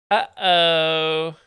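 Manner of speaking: drawn-out "Uh-oh" sung up; teasing uh-oh about a Canadian. Real, not acted. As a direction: play up the alarm.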